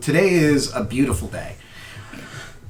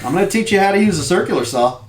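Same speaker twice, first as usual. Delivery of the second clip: high, loud, fast fatherly promise; eager dad; 9 semitones higher, 5 dB louder, faster than usual. Acted. Dad promising his son a lesson with the saw, eager and loud.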